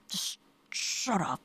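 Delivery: soft slow whisper; whispered snap. Acted. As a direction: hiss it quietly. Whispered retort.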